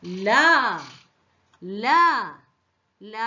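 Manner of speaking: isolated "ല", the sound of L; teacher modelling "ല" as a phonics sound; letter sound of L. Shown as a demonstration. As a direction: say "ല" alone with pauses between. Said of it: "എൽ എങ്ങനെ pronounce ചെയ്യുന്നത്?".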